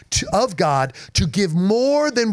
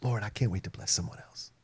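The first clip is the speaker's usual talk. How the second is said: hushed prayer; 8 semitones lower, 13 dB quieter than usual. Retold or imitated. Inner prayer quoted softly.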